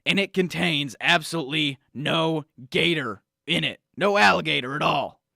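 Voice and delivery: blustery talk-radio conspiracy crank; conspiracy pundit rant. Acted. Pundit badgering the host Sam about Gatorade, blustery rant. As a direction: rant like a paranoid supplement salesman.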